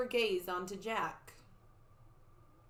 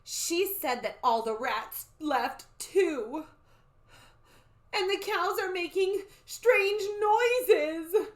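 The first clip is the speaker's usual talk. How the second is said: high, loud, drawn-out report; slow build to a theatrical cry; alarmed child; 10 semitones higher, 7 dB louder, slower than usual. Acted. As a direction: relay the omens in wailing dread. Annie relays frightening omens.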